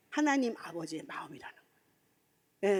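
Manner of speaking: hushed whisper of the Father's heart. Real, not acted. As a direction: drop to a reverent whisper. Sermon climax whispered.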